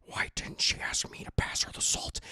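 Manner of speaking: resentful stage whisper; hammed-up jealous whisper; seething Howard. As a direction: whisper it in mock outrage to yourself. Acted repeat of resentful whisper.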